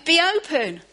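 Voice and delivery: high, loud be open; preacher urging the congregation, loud; loud call. Acted. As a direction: call it out to the room. Preacher echoes the command.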